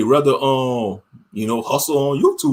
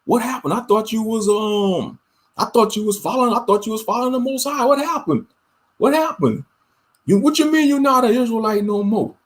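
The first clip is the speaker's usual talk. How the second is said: higher, mock-puzzled questioning; imitating family disbelief; 7 semitones higher than usual. Family members questioning one who left the faith, higher mock disbelief. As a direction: play the relative who can't believe he quit. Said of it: "They could be like".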